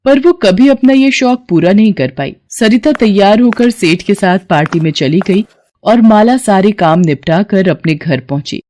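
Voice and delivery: ordinary delivery, nothing marked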